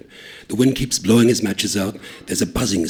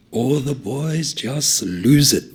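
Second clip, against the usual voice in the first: ordinary delivery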